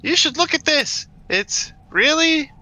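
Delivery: high, slow questioning voice; every line ending in a rising question; uptalk. Acted. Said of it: "Like, very questioning that".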